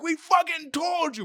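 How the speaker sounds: high, slow shouted scold; mock yell. Bystander yelling after the bull flips her, shout. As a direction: shout the told-you-so. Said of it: "he's like".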